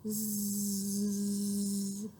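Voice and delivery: soft snoring zzz; drowsy snore sound. Acted. The sleeping owner snoring. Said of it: "his owner is saying".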